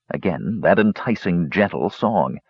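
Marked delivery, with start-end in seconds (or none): none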